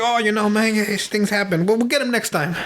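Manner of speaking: high-pitched, hammed-up movie gangster; Tony. Gangster shrugging off failure to his boss on the phone, hammed-up high voice. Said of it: "I'm butchering the Tony Soprano".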